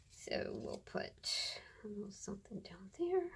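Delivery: quick soft whisper. Real, not acted. Crafter placing a scrap in a quick whisper. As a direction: whisper it under your breath.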